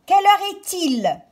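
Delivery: put-on high, loud little girl asking; high child's question. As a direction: ask like an impatient little girl. Child's line reread.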